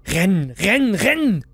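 fleeing through the forest, panic climbing; frantic running. Acted. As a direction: build the cry as fear takes over.

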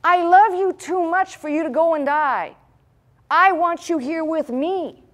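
high, loud, anxious protest; pleading disciple. Retold or imitated. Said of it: "You know what he was saying?".

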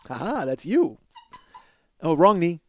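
ordinary talk

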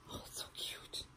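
very soft, gushing whisper; adoring whisper. Real, not acted. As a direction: whisper it, melting over cuteness. Gushes over the gifted dress.

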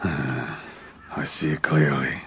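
low, slow, soft and tired; heavy resigned acceptance; weary sigh. Acted. Weary lord after loss.

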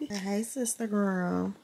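ordinary talk

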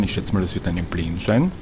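normal talking voice

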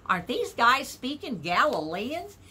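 ordinary talk, nothing marked

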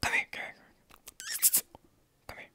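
loud hiss, then slow whisper; whisper yelling. Real, not acted. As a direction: shush hard, then whisper slowly. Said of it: "Why are you whispering?".

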